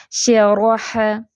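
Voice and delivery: शरह drawn out very slowly, each letter shaped; careful recitation. Shown as a demonstration. Teacher demonstrating to students, slow careful recitation. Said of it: "teacher demonstrates Arabic letter sounds".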